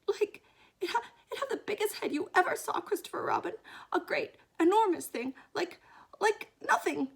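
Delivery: high, loud, stammering description; flustered, frightened Piglet. Acted. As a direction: grope for words in squeaky alarm.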